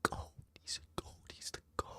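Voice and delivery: barely audible whisper; hushed little whispered chant. Real, not acted. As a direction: whisper it to yourself. Whispered chant about goat.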